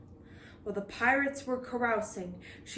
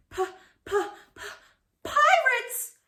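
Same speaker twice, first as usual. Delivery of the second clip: stammer, then a much higher loud cry of pirates; stuttering shout; 6 dB louder than usual. Acted. Lookout spotting pirates, stuttering alarmed shout. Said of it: "shouted Ten Pint Ted".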